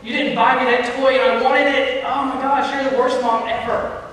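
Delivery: put-on high whining kid voice; bratty child whining at mom for a toy; whiny child. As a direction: whine like a spoiled child denied a toy. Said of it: "they're saying".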